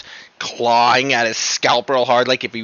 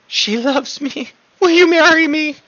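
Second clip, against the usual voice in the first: much higher, pleading imp voice; lovestruck imp. Acted. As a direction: beg like a smitten little imp. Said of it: "blushes really hard and says".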